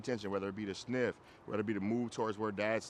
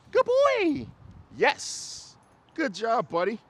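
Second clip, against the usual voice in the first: much higher and louder, bright praise; dog praise; 9 semitones higher, 7 dB louder, much slower than usual. Real, not acted. Trainer praising the dog for walking right, high excited praise. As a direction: reward the dog with your voice. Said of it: "putting everything we just did together".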